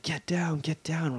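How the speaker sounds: whispered imitation of a yell; mock yelling cop. Retelling cops yelling at two tourists, whispered mock yell. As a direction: bark orders in a stage whisper. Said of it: "like, yelling at us".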